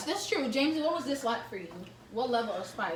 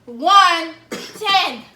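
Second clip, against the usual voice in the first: calling out; 6 semitones higher, 11 dB louder, much slower than usual. Real, not acted. Countdown called out.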